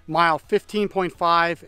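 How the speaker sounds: normal talking voice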